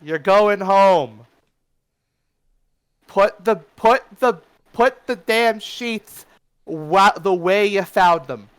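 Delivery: loud, high holler with drawn-out words; yelling out. Acted. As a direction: yell across the bar at the kids. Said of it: "he just yells out".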